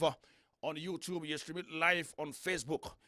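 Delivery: ordinary talk, nothing marked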